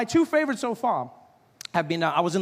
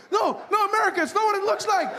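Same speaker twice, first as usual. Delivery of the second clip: high, fast frantic denial; panicky high pleading; 12 semitones higher than usual. Pretend. Frightened kid caught by America, frantic plea. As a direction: beg America not to hurt you.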